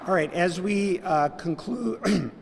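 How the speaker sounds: real catch in the throat on the last word; choked up. Real, not acted. Host closing the event, getting choked up. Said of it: "I'm getting choked up".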